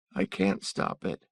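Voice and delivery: slow, breathy tearful voice; a child choking back tears; a hint of the boy sobbing; crying child. Boy crying after the robbery.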